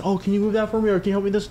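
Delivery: mocking coworker's request. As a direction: pitch it up like a pestering coworker. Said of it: "If he says".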